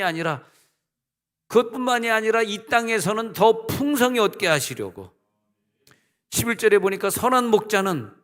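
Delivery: fervent pulpit cry over music; full-force preaching. Acted. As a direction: lift the congregation with a rising proclamation. Preaching climax.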